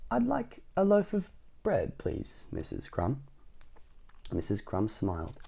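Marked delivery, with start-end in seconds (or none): 0.1-3.2 soft, slow, careful request; said quietly. A narrator's reading. Mister Noisy politely asking Missus Crumb, said quietly. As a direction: lighten right down and ask gingerly. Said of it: "then he said quietly".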